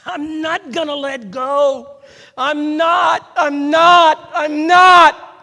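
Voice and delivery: high, full-force shout of refusal; desperate preacher's yell, building to a scream; desperate crying out. Acted. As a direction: scream it with escalating determination.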